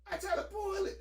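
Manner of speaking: "pull" jumps way up in disbelief. Retold or imitated. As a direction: replay the moment, voice climbing high.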